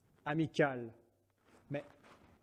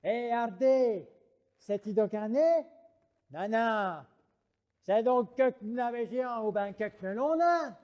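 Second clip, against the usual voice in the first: country bumpkin; 4 semitones higher than usual. Acted.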